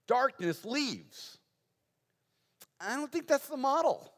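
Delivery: emphatic preaching. Real, not acted. Preacher correcting the congregation's model of evil, emphatic preaching. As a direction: preach the point with insistence. Preacher drives the point home.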